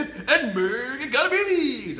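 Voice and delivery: big, high, loud morning radio host. Pretend. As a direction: boom it out like a morning DJ. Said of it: "my radio morning D J voice".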